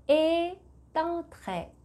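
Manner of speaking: "Est entré" drawn out very slowly, the t linked into "entré"; liaison demo. Shown as a demonstration. Teacher shows the liaison, high and slow. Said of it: "이렇게 발음이 됐어요".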